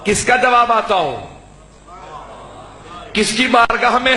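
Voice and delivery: high, ringing majlis preaching; impassioned Urdu sermon delivery; full-force preaching. Acted. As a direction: preach it out to the whole hall.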